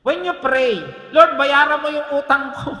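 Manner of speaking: loud, high-pitched proclamation; full-force preaching. Acted. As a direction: drive the point home to the congregation.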